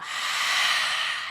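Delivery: long unvoiced exhale; breath out. Shown as a demonstration. Teacher breathes out with the children, long sigh. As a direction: let the breath out slowly. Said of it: "And a big breath out".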